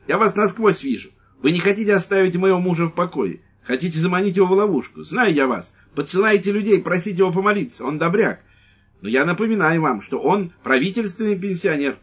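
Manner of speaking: high, loud scolding tirade; shrill angry wife. Acted. Wife scolding the lieutenant, high and loud. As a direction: berate him, suspicious and furious.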